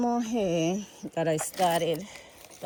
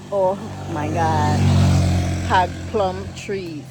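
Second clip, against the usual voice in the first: excited exclaim; 9 dB louder, slower than usual. Real, not acted. Amazed at the plum trees.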